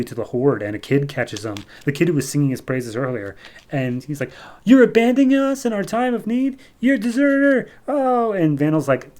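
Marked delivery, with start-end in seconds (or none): 4.6-8.3 put-on very high, distraught child voice; mock accusing kid. Kid catching Vandal sneaking off, acted shrieky accusation. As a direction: cry it out like a betrayed kid, hammed-up.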